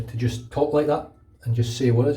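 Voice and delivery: usual speaking voice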